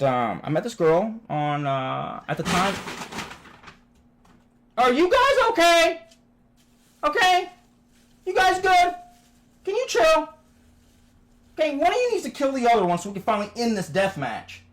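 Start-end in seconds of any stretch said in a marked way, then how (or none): 4.8-10.3 very high, loud yelling; scolding the cats. Real, not acted. Yells at his fighting cats. 11.6-14.6 high-pitched telling-off, easing downward; exasperated scolding. Real, not acted. Scolds the fighting cats.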